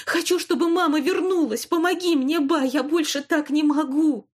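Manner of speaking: high, loud pleading cries; desperate shouting. Acted. Girl shouts to grandmother for help, high and loud. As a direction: beg at full voice, close to breaking. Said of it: "внезапно закричала она".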